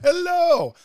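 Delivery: very high, loud, stretched hello; hammed-up cheery hello; sing-song hello. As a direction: belt out the goofy greeting. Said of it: "I don't even know where that comes from".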